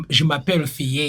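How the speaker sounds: African accent, "Fille" as "fee-yeh"; French with an African accent. Shown as a demonstration. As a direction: add a second syllable to "Fille", African-accented. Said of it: "oyo aba French with accent".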